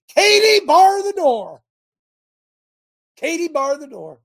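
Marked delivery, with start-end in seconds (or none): 0.1-1.6 coach's yell, much higher and louder; football coach yell. Retold or imitated. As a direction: bellow it like a coach on the sideline. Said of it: "as my football coach used to say".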